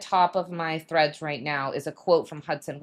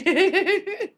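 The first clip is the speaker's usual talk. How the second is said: loud high laugh; 12 semitones higher, 5 dB louder than usual. Real, not acted. Laughs at story.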